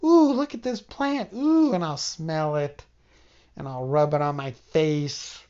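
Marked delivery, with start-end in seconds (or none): 0.0-1.7 cooing over plant. Retold or imitated. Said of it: "I'm gonna be like".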